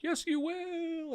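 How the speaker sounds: slow, high sing-song taunt; high drawn-out mock sing-song; taunting reply. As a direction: tease him slowly from a high pitch, like a playground chant. Taunting reply while shutting the door.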